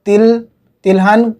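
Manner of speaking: ordinary talk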